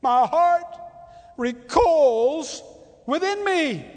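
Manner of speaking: high, loud cry of God's heart; God crying out his anguished love; voicing God. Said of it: "This is God".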